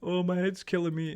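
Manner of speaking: hammed-up poor-me groaning; mock whinging. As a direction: whinge for sympathy, imitating yourself. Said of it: "whinge all day".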